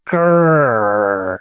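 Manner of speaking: deep low tiger growl. Pretend. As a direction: growl low like a big cat. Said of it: "give us your best tiger roar".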